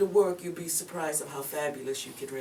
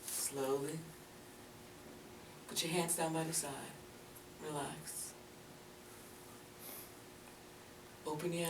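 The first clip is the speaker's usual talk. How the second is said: guided relaxation; 17 dB quieter than usual. Acted. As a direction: ease her into calm, barely above a murmur. Leads a relaxing breathing exercise.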